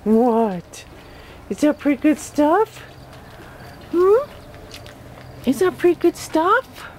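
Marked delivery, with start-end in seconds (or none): 1.5-6.9 talking to a dog. Real, not acted. Owner baby-talks dog at dinner.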